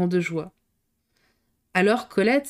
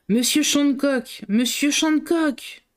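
high, slow, ringing call; powerful voice calling; 7 semitones higher, much slower than usual. A narrator's reading. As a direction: call his name from close by, strong. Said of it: "une voix puissante retentit tout près d'eux". (appel puissant et lent)

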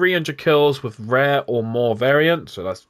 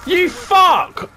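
angry outburst; 14 semitones higher, 4 dB louder than usual. Real, not acted. Angry at a zombie.

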